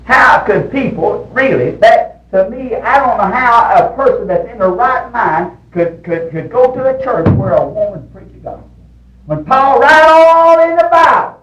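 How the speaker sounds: high, loud indignant preaching; forceful preaching. Real, not acted. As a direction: build to a long, high ringing cry.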